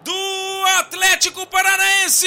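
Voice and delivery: building from tense call to huge goal scream; explosive goal commentary. Real, not acted. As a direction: rise into a stretched, shouted goal.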